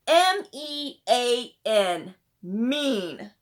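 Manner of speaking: each letter of "M E A N" said slowly and loud, then "mean" hit hard; a girl spelling "M E A N" slowly for emphasis; spelled out slowly. A narrator's reading. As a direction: spell "M E A N" letter by letter, slow and firm.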